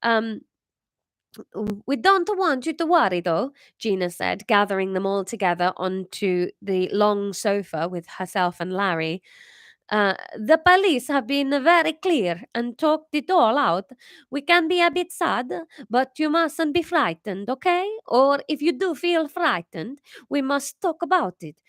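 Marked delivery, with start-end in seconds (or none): 1.8-3.5 put-on higher French-accented mother. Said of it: "That was okay, that sentence". 10.5-21.6 put-on high, gentle French-accented mother; soothing the children in a French accent. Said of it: "French accent".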